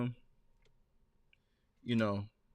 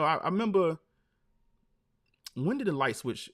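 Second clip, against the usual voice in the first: ordinary talk, nothing marked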